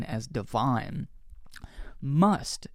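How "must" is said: "must" set apart, higher and drawn out; stress on must. Real, not acted. Podcaster questioning Dawkins's leap to Jesus, heavy stress on "must". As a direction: lean hard on "must" after a pause. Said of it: "emphasis on the word must".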